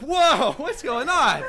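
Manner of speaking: high, loud mock alarm; startled. Guest reacting to dad's sudden shout, startled. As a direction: react with a startled, high-pitched yelp of words.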